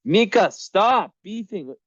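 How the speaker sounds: high, loud, slow scolding of the cat; calling out to cat. Real, not acted. Owner breaking up her cats' fight, loud high scolding call.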